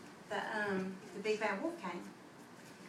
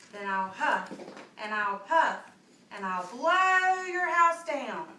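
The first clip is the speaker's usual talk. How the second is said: building wolf threat; 9 dB louder, slower than usual. Acted. Big bad wolf threatening the pig, building to a roar. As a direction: huff and puff up to a big roar.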